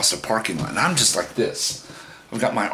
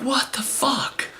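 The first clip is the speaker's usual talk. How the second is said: high, drawn-out acted disbelief; incredulous outburst; much slower than usual. Man to date after she let go, high incredulity. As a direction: stretch it out in high-pitched astonishment. Acting out his outrage afterwards.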